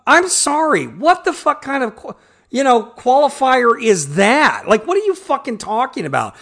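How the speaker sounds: exasperated, high-pitched challenge; incredulous outrage. Real, not acted. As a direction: call out an absurd excuse, appalled. Mocking a retold excuse in disbelief.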